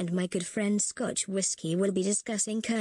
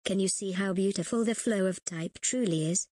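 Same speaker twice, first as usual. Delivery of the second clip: ordinary talk, nothing marked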